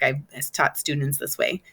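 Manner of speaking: usual speaking voice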